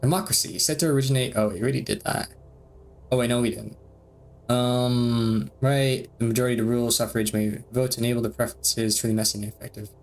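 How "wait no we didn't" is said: ordinary talk, nothing marked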